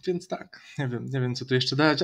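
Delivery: ordinary talk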